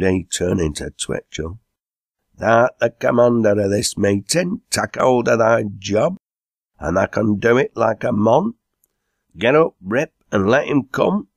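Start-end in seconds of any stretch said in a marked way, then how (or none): 2.4-11.3 rousing, higher-pitched dialect character; bossy dialect pub-goer. A narrator's reading. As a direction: urge a mate to take charge.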